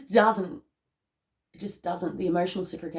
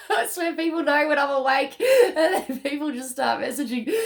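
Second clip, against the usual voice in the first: high, loud, laughing through the words; 9 semitones higher, 5 dB louder than usual. Real, not acted. Laughing that people message her as soon as she wakes. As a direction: giggle while you talk.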